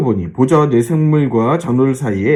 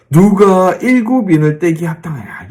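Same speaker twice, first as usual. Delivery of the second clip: raised, higher proclaiming voice; angel's proclamation; 5 semitones higher, 4 dB louder than usual. Retold or imitated. Preacher voices the mighty angel's challenge, proclaiming. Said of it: "이렇게 선포하고 있다는".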